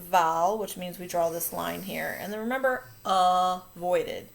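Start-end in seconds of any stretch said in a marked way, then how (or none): 3.0-4.3 "avoided" as "uh-voided", the "uh" loud; schwa first syllable. Shown as a demonstration. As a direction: set off a loud "uh" at the start of "avoided". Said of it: "A makes the uh sound".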